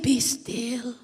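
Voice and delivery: soft, slow whisper; God's hushed reassurance. Acted. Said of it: "But God is saying". (pabulong na boses ng Diyos)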